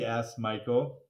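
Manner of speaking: ordinary delivery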